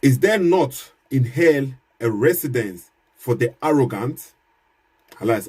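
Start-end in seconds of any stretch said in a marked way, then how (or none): none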